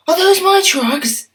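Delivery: high, eager little engine voice; high grateful engine voice; little engine Stepney. Acted. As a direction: squeak it out like a grateful small engine. Stepney asks.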